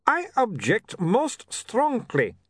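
lightly voiced vampire, slowly taking offence; slow offended Uberwald accent. A narrator's reading. Otto offended.

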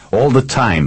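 ordinary delivery, nothing marked

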